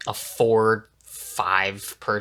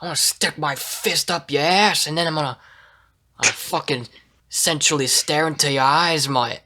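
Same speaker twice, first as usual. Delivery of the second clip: British tough guy; 5 semitones higher than usual. Retold or imitated. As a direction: put on a cheeky British accent, threat turning flirty. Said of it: "when I focus too much on the accent".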